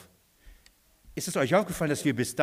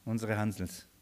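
normal talking voice

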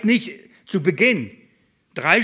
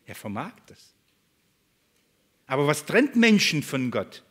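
ordinary talk

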